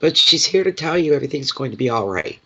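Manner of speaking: ordinary delivery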